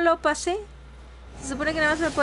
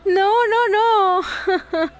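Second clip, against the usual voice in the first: loud protest; 6 semitones higher, 7 dB louder, much slower than usual. Real, not acted. Chat can hear her finally.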